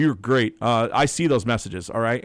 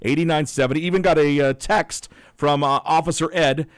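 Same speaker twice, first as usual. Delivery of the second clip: louder, upbeat announcer read; radio announcer read; 4 semitones higher, 8 dB louder than usual. Acted. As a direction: read the weather to listeners on air. On-air weather and phone read.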